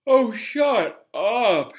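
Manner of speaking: slow, drawn-out mock yawn; sleepy boy yawning at a painting. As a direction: stretch it through a yawn. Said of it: "Harry yawned".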